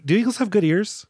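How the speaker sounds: ordinary delivery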